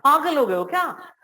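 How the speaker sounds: disbelieving shocked question; shocked reply. Retold or imitated. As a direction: react as if they lost their mind. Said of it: "सामने वाला सुन के जरूर कहेगा".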